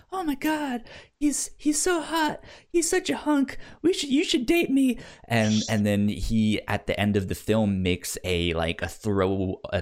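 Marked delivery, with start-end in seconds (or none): 0.1-5.0 high, squealing mock crush voice; mock lovestruck teen gushing; gushing older sister. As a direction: swoon over the hunk, hammed up and girly. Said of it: "older sister is all like".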